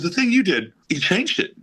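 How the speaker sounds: high imitation of Paul praising; light, high Paul impersonation; Paul impression. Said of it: "he goes".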